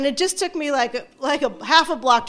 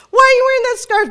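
much higher and louder, demanding question; nosy kids; 11 semitones higher, 7 dB louder, faster than usual. Retold or imitated. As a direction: students pounce on the new look. Students questioning the teacher.

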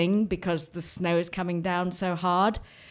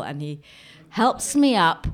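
ordinary talk, nothing marked

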